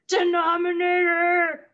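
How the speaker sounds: high, slow drawn-out monster wail; scary monster voice. Pretend. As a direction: boom it out like a movie monster. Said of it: "do it one more time".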